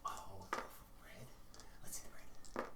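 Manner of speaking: breathy unvoiced whisper, eager; secretive bird whisper. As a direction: hush it like a greedy little bird. Bird whispers back, intrigued.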